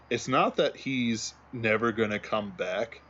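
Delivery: ordinary delivery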